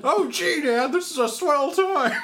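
put-on high golly-gee kid voice; fake wholesome. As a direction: chirp it like a fifties kid. Mocking kid's wholesome praise.

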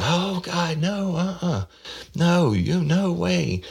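falsetto panicked woman pleading; high mock wail. Detective imitates the suspect pleading innocence, falsetto whine.

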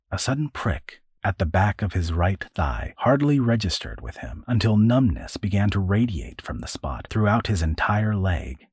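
usual speaking voice

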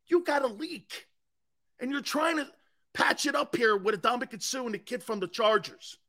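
ordinary delivery

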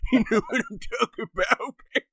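high, quick laughing innuendo. Real, not acted. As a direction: giggle through the wink. Suggestive joke, laughing.

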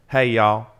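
folksy Southern-accented greeting. Shown as a demonstration. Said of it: "I gotta do a Southern accent for that".

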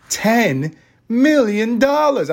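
slow, drawn-out villain reading of ten million dollars; a light hint of Doctor Evil savouring the sum. Reading the lawsuit aloud, a lightly voiced Doctor Evil. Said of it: "I say it like fucking Doctor Evil".

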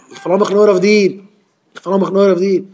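high, loud, insistent prayer; fervent supplication. Acted. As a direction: plead upward with full insistence. Acted declaration of reliance on God.

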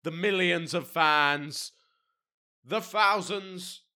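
loud, high, drawn-out mock announcing; hammed-up hype shout. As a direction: shout it slowly like hyping a huge crowd. Hype of imaginary huge audience.

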